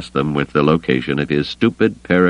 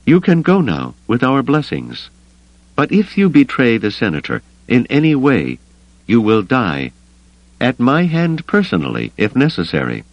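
higher-pitched, deadly serious threat; earnest woman's voice, pitched up, easing at the end; 7 semitones higher than usual. A narrator's reading. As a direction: warn them in dead earnest. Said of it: "she said with deadly earnestness".

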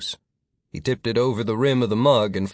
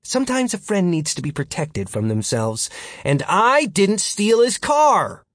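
Martin, arguing back; 10 semitones higher than usual. Acted. Martin justifies protecting Philip from himself, pointed rebuttal.

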